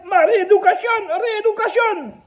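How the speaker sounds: put-on caricatured Portuguese accent. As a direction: play the broken French of a Portuguese worker. Second Portuguese patient answers.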